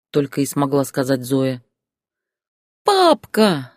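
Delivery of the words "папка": put-on a child's high, louder shout of joy; joyful cry. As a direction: call out to dad delighted. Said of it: "радостно воскликнул Лёшка". (мальчик радостно кричит отцу, высоко и громко)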